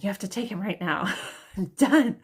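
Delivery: exasperated outburst. Retold or imitated. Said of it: "so mad".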